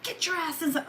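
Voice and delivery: high, held-back mock yell; mock scolding. Owner ordering cat indoors, high mock scolding. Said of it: "I'm so fucking mad".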